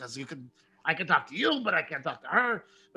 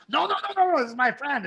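wolf defending friend; 9 semitones higher, 3 dB louder, faster than usual. Acted. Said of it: "and he goes".

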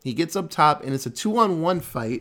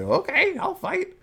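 mock drunk squeak, much higher; drunk Jackie. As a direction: pitch it way up like a tipsy fighter. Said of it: "and Jackie's like".

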